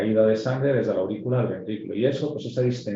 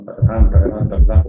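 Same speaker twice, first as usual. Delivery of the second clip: loud vocalised galloping heartbeat; imitated gallop rhythm; 11 dB louder, slower than usual. Shown as a demonstration. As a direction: beat out the heart's gallop loudly with the voice. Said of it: "es lo que comentábamos antes".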